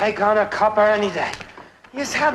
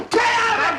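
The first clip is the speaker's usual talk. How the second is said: high indignant yell; protesting yell; 12 semitones higher, faster than usual. Acted. Man fighting off beard shavers, protesting yell.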